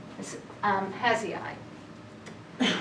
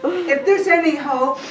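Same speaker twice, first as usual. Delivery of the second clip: laughing; 5 semitones higher, 9 dB louder than usual. Real, not acted. Still laughing after the joke.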